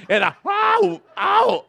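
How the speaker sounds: high yelps of pretend pain; mock cry of pain. Preacher acting out being hurt, high yelps. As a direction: squeal ow, ow, hammed-up.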